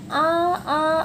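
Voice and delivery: "आ" held out over three beats; long drawn vowel. Shown as a demonstration. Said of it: "आ ऐसे करके मैं तीन बार लिख दूँ". ("आ" को तीन मात्रा तक खींचकर)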